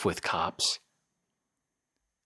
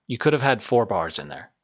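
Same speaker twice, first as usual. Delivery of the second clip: ordinary talk, nothing marked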